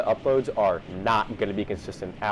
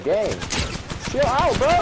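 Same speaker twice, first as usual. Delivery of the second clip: very high, loud protest; panicked yell; 13 semitones higher, 4 dB louder, much slower than usual. Real, not acted. Gamer begs his attacker to stop, panicked. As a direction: yelp it at an attacker. Pleads with attacker under fire.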